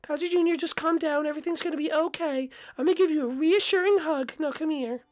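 calm your nervous son down gently; soothing mom voice. Acted.

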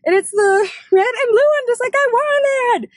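high-pitched, loud shrieks of joy; excited squealing. Real, not acted. Vlogger telling viewers they got it, excited shrieking. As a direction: squeal the big news at the top of your voice. Celebrating getting the new console.

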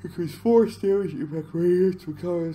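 talking through a yawn. Real, not acted. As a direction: stretch the words with a yawn. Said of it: "sorry about the yawning".

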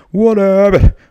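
loud and high mock [name], direct and flat; imitating [name]. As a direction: belt it out plainly like [name]. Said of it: "Eso no hace [name]". (imitando a [name], fuerte y agudo, sonido directo y plano)

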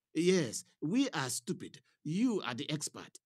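a junior pastor taking offence; huffy. A narrator's reading. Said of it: "Sammy huffed".

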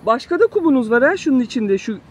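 ordinary talk

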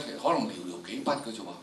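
ordinary delivery

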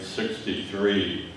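ordinary talk, nothing marked

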